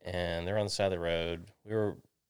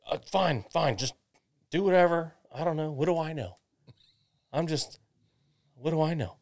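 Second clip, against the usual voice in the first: high-pitched, exasperated dad imitation; huffy surrender; 8 semitones higher, 6 dB louder than usual. Dad giving in on the trailer fix, huffy. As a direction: act out dad giving up in a huff.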